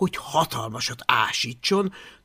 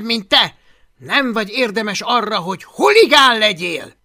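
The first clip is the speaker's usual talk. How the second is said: louder, higher scornful tirade; bullying threat; 7 semitones higher, 8 dB louder than usual. Acted. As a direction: sneer him down. Takonypóc fenyegetőzik. (gúnyos, fenyegető kiselőadás)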